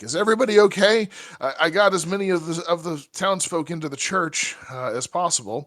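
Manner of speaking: fast, anxious priest checking on everyone. Acted. Father Xantus rushing to check on the party, fast and worried. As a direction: rush out concerned questions as the priest. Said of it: "just be like".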